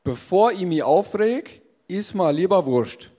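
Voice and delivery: slow Bavarian reading of "aufreg"; Bavarian dialect. Shown as a demonstration. Reading a fortune cookie aloud in slow Bavarian dialect. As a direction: read it out slowly in Bavarian. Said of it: "Lies doch mal auf bayerisch vor".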